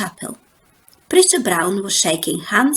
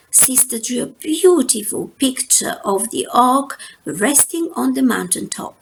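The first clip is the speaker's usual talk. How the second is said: ordinary delivery, nothing marked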